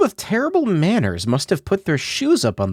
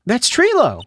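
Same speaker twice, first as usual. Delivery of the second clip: surprised exclamation; 10 semitones higher, slower than usual. Real, not acted. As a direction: voice jumps up, excited discovery. Finding the lost puppet.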